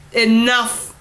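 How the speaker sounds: put-on a king roaring at squabbling children; roared. A narrator's reading. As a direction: roar to silence the room. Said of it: "the king roared".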